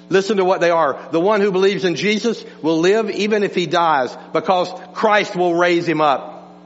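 ordinary talk, nothing marked

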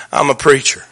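low, hushed admission; quiet confession. Retold or imitated. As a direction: drop low and soft to confess it. Retelling a hard confession.

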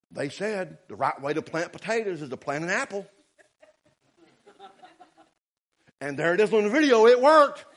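mock-serious retelling, building to a loud, high punchline; sarcastic. Real, not acted. Preacher mocks influencers' advice to the congregation, sarcastic. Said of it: "I'm being sarcastic".

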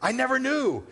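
high, amazed gasp of a line; mock astonishment. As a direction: sound truly caught off guard. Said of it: "You don't want them to be like".

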